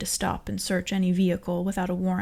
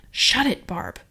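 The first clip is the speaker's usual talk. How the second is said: snapping shut up; 3 semitones higher, 5 dB louder, slower than usual. A narrator's reading. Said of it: "said the driver".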